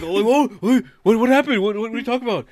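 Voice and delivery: high, loud, panicky denial; flustered caught coworker. Retold or imitated. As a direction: play the guilty guy caught out.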